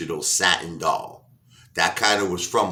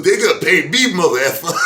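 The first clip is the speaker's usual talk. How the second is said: high, loud mock outburst; angry vow; 10 semitones higher, 7 dB louder than usual. Pryor vowing to get the singer paid, mock angry shout. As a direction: shout it in a put-on fury. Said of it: "He goes".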